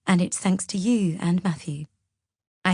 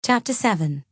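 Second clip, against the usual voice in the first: ordinary delivery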